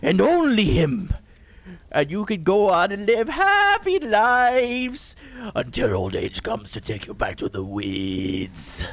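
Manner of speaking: high, slow, menacing clown bargaining; Pennywise. Retold or imitated. Clown negotiating with the kids, slow and menacing. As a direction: draw out the deal like a monster. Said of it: "the line where he's like".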